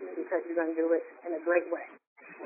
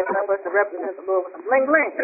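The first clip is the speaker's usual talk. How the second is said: ordinary talk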